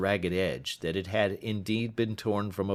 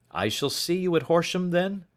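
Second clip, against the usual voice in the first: put-on higher, earnest young man; worried young client explaining; young visitor; 11 semitones higher, 4 dB louder than usual. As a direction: voice the anxious visitor. Openshaw's dialogue voice.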